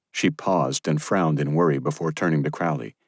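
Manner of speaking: ordinary delivery, nothing marked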